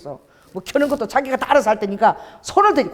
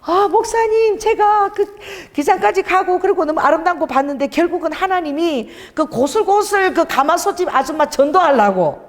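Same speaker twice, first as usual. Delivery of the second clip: high-pitched, eager imitation of a guileless believer; childlike innocent evangelist; 7 semitones higher than usual. Evangelist phoning the pastor about God's purpose, imitated in a high eager voice. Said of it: "애기처럼 순수한 분인데".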